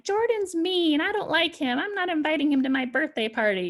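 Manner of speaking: put-on high-pitched child complaining about a playmate; petulant kid voice, pitched well up; upset child. Child complaining about Jordan to an adult, petulant. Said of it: "or maybe they're like".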